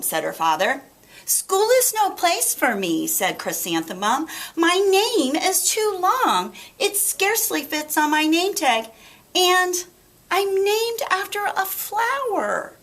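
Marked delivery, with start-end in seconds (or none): 1.2-12.8 put-on whiny little girl. Chrysanthemum complaining to her parents, high and sulky.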